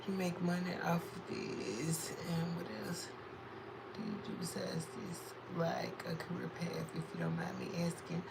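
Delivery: breathy unvoiced whisper; soft whispered read-out. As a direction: whisper the question like a secret. Reading question in whisper.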